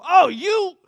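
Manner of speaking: sudden high furious yelp at another driver; road rage outburst. Retold or imitated. Preacher acting out a driver who got cut off, furious yell. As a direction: blurt it out like someone just cut you off.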